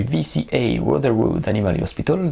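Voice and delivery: a hint of an English accent on the American name. Real, not acted. French vlogger apologising, reads the name in an English accent. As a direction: says the clinic name lightly in an English accent. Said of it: "je m'excuse pour mon accent anglais".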